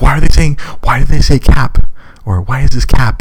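loud, high baffled questioning. Retold or imitated. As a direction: ask it utterly confused. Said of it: "'Cause I'm like".

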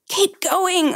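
narrated scream. A narrator's reading. Trapped hiker to rescuers pulling the leg free, restrained scream. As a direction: urge them on in a high, restrained scream. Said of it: "I screamed".

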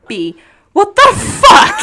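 high, slow laughing yell; laughing shout. Retold or imitated. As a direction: shout it while cracking up. Retold childhood outrage.

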